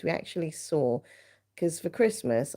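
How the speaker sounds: normal talking voice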